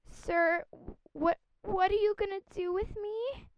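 high nervous stammering question. Acted. Sophie asks nervously.

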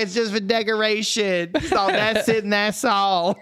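lightly voiced sidekick; quoting sidekick girl. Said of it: "his sidekick girl, she's like".

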